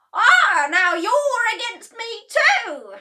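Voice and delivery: very high, very loud slow outrage; Grandma shouting. A narrator's reading. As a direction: accuse her own daughter. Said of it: "shouted Grandma".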